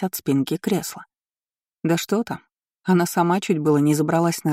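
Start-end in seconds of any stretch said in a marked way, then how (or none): none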